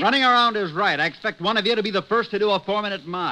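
husband snapping at wife; angry husband. Acted. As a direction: pick the fight. Married couple quarrel.

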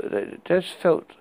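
usual speaking voice

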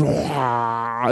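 low soft mock fish-mouth sound; quiet low comic fish noise; fish gulp noise. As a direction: imitate a bass gaping its mouth. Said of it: "he just came up and he's".

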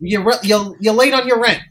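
high, loud Brooklyn landlady nagging; Brooklynese landlady. Acted. Brooklyn landlady demanding late rent from tenant, high and loud. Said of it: "she talks just right up here".

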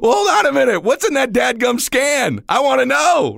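high, loud, slowed mock-angry shout; getting mad. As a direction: demand it like a father who just got scared. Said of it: "getting mad".

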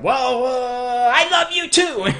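high, squeaky, hammed-up; the partner's high, silly answer to the loving words; mock partner reply. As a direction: play the smitten partner for a laugh. Partner's reply voiced mockingly.

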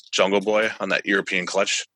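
normal talking voice

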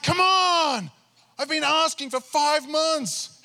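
very high, loud, slow pleading whine; exasperated plea. Retold or imitated. Pastor retelling begging God for an answer, high exasperated whine. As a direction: drag it out, fed up.

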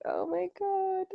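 high, slow, put-on groan of disbelief; hammed-up cringe; mock horror. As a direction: drag out a high oh my God in embarrassment. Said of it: "I was just like".

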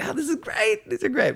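very high, gushing exclamation; mock delight. Teller acts out own delighted reaction.

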